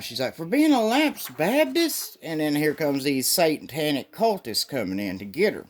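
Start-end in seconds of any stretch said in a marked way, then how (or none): 0.4-2.2 high, slow put-on girl's disbelief; mock disbelief. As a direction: stretch it out in mock incredulity. Lana incredulous at arrest.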